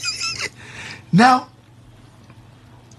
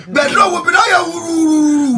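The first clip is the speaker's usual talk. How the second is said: alarmed cry; 8 semitones higher, slower than usual. Retold or imitated. Panic recalling stuck landing.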